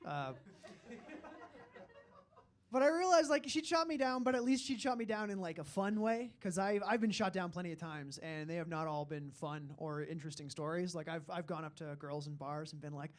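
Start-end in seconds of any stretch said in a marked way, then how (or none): none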